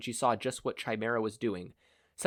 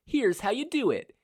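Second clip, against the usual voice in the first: cocky taunt; 12 semitones higher than usual. A narrator's reading. Fighter taunting mid-attack.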